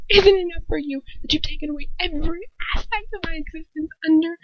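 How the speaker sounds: high, fast, furious outburst; outraged shout. A narrator's reading. Girl raging at Lucius for controlling her whole existence. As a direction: let it pour out at him.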